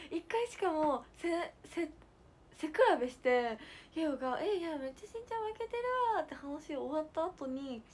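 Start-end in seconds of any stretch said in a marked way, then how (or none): 4.4-6.2 put-on child's voice. Retelling the child after measuring height.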